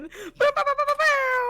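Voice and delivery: mock horn blast. Sound effect for Patreon plug.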